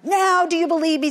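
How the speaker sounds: fast, loud boastful witch; witch taunting. Acted. Witch boasting to Nisam after her spell, fast and loud. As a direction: crow at him with triumph. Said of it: "said the witch".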